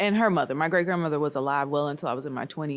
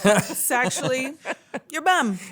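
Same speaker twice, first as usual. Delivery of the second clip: laughing; 9 semitones higher, 5 dB louder than usual. Real, not acted. Amused reveal about cover photo.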